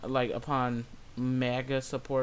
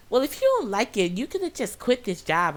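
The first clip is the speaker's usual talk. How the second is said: raised, higher-pitched mock customer; snippy Karen; 7 semitones higher, 4 dB louder than usual. Customer telling cashier to quit, snippy. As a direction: imitate her snapping back, pitched up. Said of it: "she's just like".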